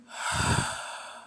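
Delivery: long, heavy calming exhale; deep breath. Retold or imitated. Deku told to calm down, deep breath out. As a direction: blow out one slow, deep breath. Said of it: "Deep breaths as he's like".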